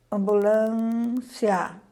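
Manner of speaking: ambulância drawn out very slowly; slow demo word. Shown as a demonstration. Teacher reads ambulância aloud for the class, very slow. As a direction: stretch ambulância syllable by syllable. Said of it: "Ao pronunciarmos essas palavras".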